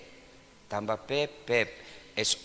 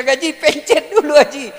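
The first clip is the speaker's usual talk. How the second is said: calling out; 12 semitones higher, 11 dB louder than usual. Retold or imitated. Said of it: "Saya bilang".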